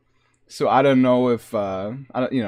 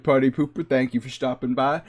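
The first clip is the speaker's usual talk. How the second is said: ordinary talk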